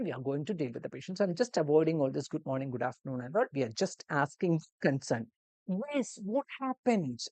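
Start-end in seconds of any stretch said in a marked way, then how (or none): 5.9-7.2 slow, higher, gentle question; caring nurse tone. Shown as a demonstration. Nurse greeting a new emergency patient, gently. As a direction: greet the patient kindly, slowly. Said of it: "That intonation you need to focus on".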